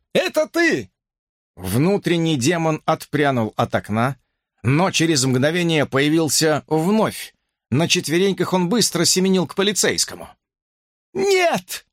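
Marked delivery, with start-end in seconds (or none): 0.1-0.9 high, strained call from far off; surprised shout. A narrator's reading. Cop calling out in storm. 11.1-11.9 high, frightened gasp of a word; startled breathless no; breathed out. A narrator's reading. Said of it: "выдохнула Брук".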